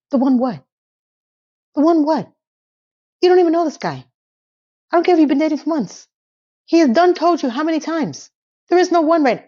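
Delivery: high-pitched, incredulous telling-off; exasperated rebuke. Acted. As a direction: scold her like a fed-up friend. Acted exasperated rebuke of Carrie.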